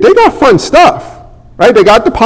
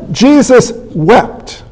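ordinary delivery

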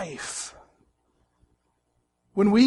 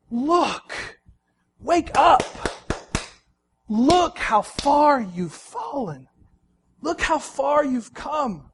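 pleading rebuke; 5 semitones higher than usual. Acted. Jesus rebukes church, voiced.

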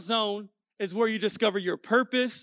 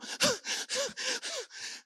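put-on high breathy gasps, like winded kids; huffing for air; mimicked panting; 8 dB quieter than usual. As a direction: act out a child completely out of breath.

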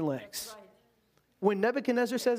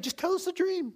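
pleading wise men; 10 semitones higher than usual. Retold or imitated. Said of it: "They're saying".

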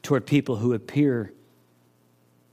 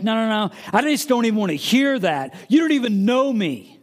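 defensive brush-off; 8 semitones higher than usual. Retold or imitated. Voicing a defensive person.

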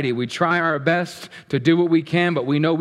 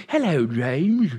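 hammed-up British greeting, drawn out slowly; tea-sipping, pinky-up British caricature; British accent; slower than usual. Pastor leading congregation greeting James, exaggerated British accent. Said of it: "Say it in your best British accent ever, while sipping tea".

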